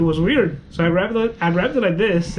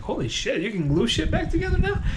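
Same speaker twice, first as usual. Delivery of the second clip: high, hushed, amazed question; re-enacted astonishment, pitched way up; retold amazement; 6 semitones higher, 4 dB quieter than usual. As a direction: marvel in a soft, raised voice. Acts out amazement at the nurse.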